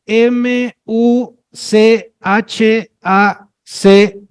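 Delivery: letter by letter, very slowly; spelling out. Shown as a demonstration. Teacher quizzing the class on a word, spelling slowly. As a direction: spell it out with a gap after each letter. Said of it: "la palabra que se escribe".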